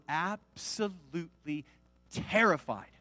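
slow, hushed, syllable by syllable; drawn-out emphasis. A narrator's reading. Narrator telling how the disciples felt, slow and hushed. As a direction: stretch each syllable for dramatic weight. Dramatic reading of the disciples' terror.

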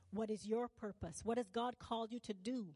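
usual speaking voice

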